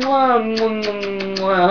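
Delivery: kissing sounds into the mic; blown kisses. Real, not acted. Blowing kisses to family.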